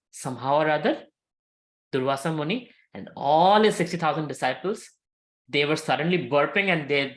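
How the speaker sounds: usual speaking voice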